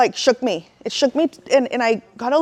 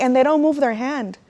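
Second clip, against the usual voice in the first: ordinary talk, nothing marked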